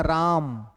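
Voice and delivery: राम with a long, stressed "aa"; राम, weight held on its middle vowel. Shown as a demonstration. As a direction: lean on the आ in राम and draw it out. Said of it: "आ पर विशेष बल पड़ गया".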